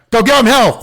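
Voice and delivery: loud, high sideline holler; full-voiced mock cheer; rallying shout. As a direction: yell it like you're spurring on a team. Mock rallying call on the field.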